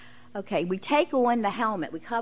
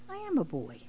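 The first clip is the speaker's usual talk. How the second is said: put-on little boy; 8 semitones higher, 4 dB quieter than usual. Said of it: "And he said".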